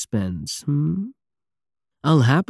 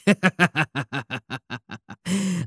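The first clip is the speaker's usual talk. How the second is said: pleased laugh; 6 semitones higher, 3 dB quieter than usual. Acted. Laughs at crew's cheer.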